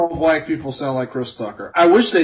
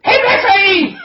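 high, loud laughing yell; Chris Tucker voice; 6 dB louder, slower than usual. Pretend. Answering a friend's nickname call in a high Chris Tucker yell. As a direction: bellow it like a hyped Chris Tucker. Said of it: "answer him in the Chris Tucker voice".

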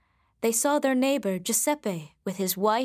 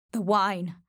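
ordinary delivery

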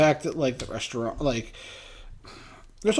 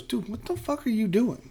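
high, slow, softly voiced disbelief; baffled friend; 7 semitones higher, 8 dB quieter than usual. Buddy Robbie questioning him at Fenway, baffled. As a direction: quote a baffled buddy, pitched up and hushed.

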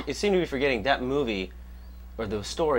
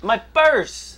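high, loud cry of alarm; panicked victim; 10 semitones higher, 6 dB louder than usual. Pretend. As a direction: shriek about your stolen purse. Acting robbery victim crying out.

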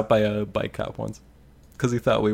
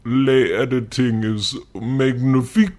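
put-on David Cage French accent. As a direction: say it like a French director. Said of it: "Good, good David Cage impression".

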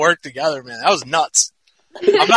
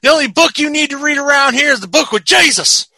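high-pitched backwoods preacher; mock Bible preacher; 8 semitones higher, faster than usual. Local zealot pushing the book with Jesus, mock preaching. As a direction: preach it high, drawing to a big finish.

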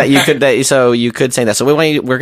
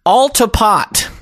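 British accent; 10 semitones higher, much slower than usual. Shown as a demonstration. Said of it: "What did you write there?".

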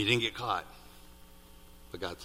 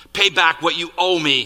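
loud, higher-pitched demand; 5 semitones higher, 9 dB louder than usual. A narrator's reading. Servant to fellow servant who owes him, demanding. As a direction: demand it forcefully, as in a dramatic reading. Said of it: "he demanded".

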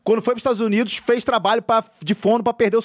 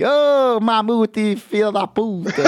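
put-on Northeastern accent; 3 semitones higher, much slower than usual. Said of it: "era o nordestino que fazia".

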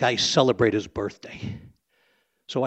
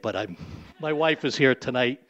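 ordinary talk

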